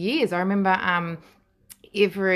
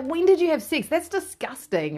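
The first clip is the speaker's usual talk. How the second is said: grossed-out younger sister; 10 semitones higher than usual. Retold or imitated. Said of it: "She's like".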